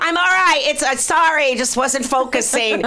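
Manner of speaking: high, projected apology to a crowd; mock public announcement. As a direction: announce it to the whole store. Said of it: "like, making an announcement".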